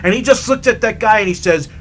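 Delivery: ordinary delivery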